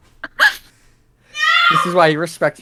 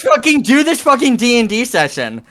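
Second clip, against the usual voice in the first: mock angry shout; 8 semitones higher than usual. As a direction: yell at them to shut up. Mock angry outburst at friend.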